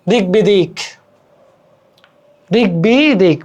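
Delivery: দিক বিদিক said "dik-bi-dik"; unchanged k and b. Shown as a demonstration. Teacher illustrating unchanged sounds, careful দিক বিদিক. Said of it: "অপরিবর্তিত থাকবে".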